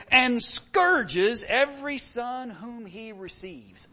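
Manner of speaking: slow and deliberate, weighting every word; slow emphatic scripture. Shown as a demonstration. Preacher stresses the verse to his congregation. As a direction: let the scourging land heavily. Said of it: "And notice".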